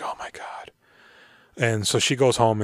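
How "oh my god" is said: whispered, softer gasp of shock; imitating a stunned whisper. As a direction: breathe it out in stunned disbelief. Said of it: "And she just is like".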